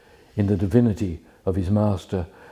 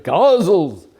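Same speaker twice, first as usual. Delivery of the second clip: higher, emphatic one-word bellow; imitated shout; 7 semitones higher, 5 dB louder than usual. Imitating a man shouting the same word, emphatic shout. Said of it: "he shouted and shouted at the same word".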